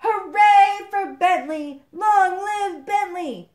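loud, high, drawn-out hurrah; slow, ringing shout of acclaim; cheering crowd. Acted. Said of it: "a great hue and cry".

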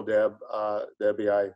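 ordinary delivery

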